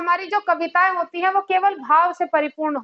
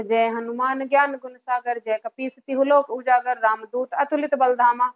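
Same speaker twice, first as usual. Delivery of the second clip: rhythmic recitation; 5 semitones lower than usual. Shown as a demonstration. Teacher reciting Hanuman Chalisa to show लय, steady rhythmic recitation. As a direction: recite in one continuous flowing rhythm. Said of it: "पूरी एक लय आ जाती है".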